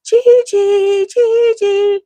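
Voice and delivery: put-on high little kid calling out; child calling Gigi. Grandkids calling up for Gigi, high child's voice. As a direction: call up sweetly like a toddler.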